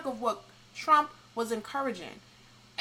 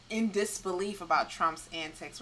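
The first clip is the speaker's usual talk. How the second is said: ordinary talk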